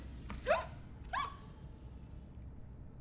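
high, breathless gasp; sharp high gasp. Real, not acted. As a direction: gasp in alarm. Sudden gasp sound.

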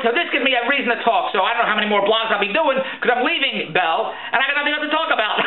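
normal talking voice

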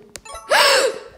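sharp high gasp of fright; startled gasp. Real, not acted. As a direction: gasp as if caught by the killer. Scared by the murderer. (hoquet de peur)

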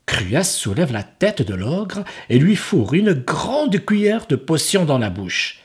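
ordinary delivery

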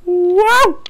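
very high, loud amazed "Wow!"; stunned wow. Real, not acted. Gamer reacts to a sudden fall, stunned high wow. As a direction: let the shock jump out.

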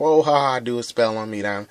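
high, loud jeering laugh; sneering park heckler; mocking taunt. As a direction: mimic a teasing bully. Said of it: "people at the park always talking crap".

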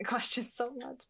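ordinary talk

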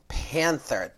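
loud, thrilled card reveal; excited reveal. Real, not acted. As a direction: announce it with a burst of joy.

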